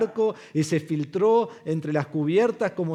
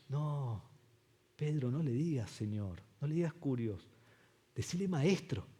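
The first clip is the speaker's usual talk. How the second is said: softly correcting a friend; low-key mock advice; mock calm quiet; 4 semitones lower, 13 dB quieter than usual. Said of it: "viene otro discípulo y le dice". (corrección tranquila y burlona en voz baja)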